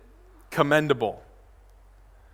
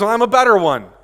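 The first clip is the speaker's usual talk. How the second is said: loud, higher, puffed-up claim; arrogant boast; 5 semitones higher, 7 dB louder than usual. Acted. Pastor voicing Paul's claim to the church, arrogant. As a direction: brag it out boldly as if outdoing everyone. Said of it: "he's a little arrogant, puffed up".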